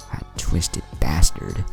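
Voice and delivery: ordinary delivery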